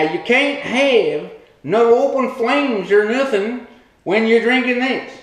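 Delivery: ordinary talk, nothing marked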